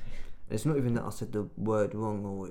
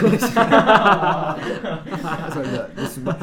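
high, loud retelling through laughter; mock fed-up, breaking into laughs; laughing exasperation; 7 semitones higher, 14 dB louder, much slower than usual. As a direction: lose patience but crack up. Acting out losing patience, laughing.

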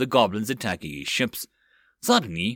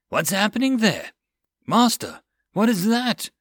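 much higher, alarmed call; surprised cry; 9 semitones higher, 3 dB louder than usual. Acted. Lookout crying out to the master, surprised. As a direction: cry out at the strange sight. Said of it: "the lookout cried".

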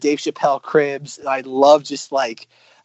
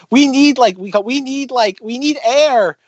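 mock yelling woman; 9 semitones higher than usual. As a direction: yell it up high like a panicked worker.

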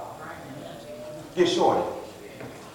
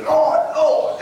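both words of "Lord, Lord" hit hard and pitched up; stressed "Lord, Lord"; 7 semitones higher, 4 dB louder than usual. Shown as a demonstration. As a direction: lean emphatically on each "Lord" in "Lord, Lord". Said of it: "that's stress".

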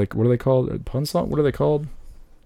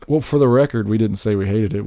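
ordinary delivery

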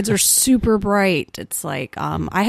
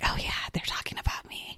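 a fast, hushed inner thought; whispered aside; 15 dB quieter, faster than usual. Retold or imitated. New teacher noticing students talk about her, quiet whispered aside. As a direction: murmur it to yourself, a bit self-conscious.

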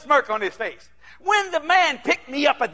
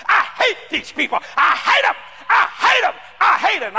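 higher, building acted outburst; mimicked hateful rant; 6 semitones higher than usual. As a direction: imitate an angry pastor, pitch climbing with each line. Acting out hate-filled pastor ranting.

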